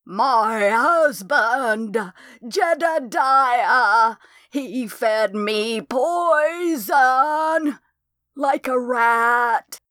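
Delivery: high, very slow, otherworldly drawl; ghost voice. A narrator's reading. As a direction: let each word hang like a dead woman's voice. Ghost voice speaks through Candy.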